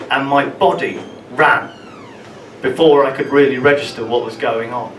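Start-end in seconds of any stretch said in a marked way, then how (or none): none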